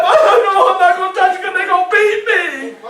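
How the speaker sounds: high loud shouted protest; hammed-up frantic no; desperate refusal. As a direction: shout the refusal through laughter. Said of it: "But it's steady going up".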